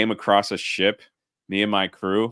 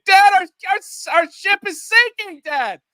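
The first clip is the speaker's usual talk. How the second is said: put-on much higher, louder child wailing for his dad; panicked kid; 7 dB louder than usual. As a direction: squeal like a little kid whose ship is sinking. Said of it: "you could hear the kid".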